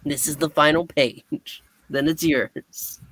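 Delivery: usual speaking voice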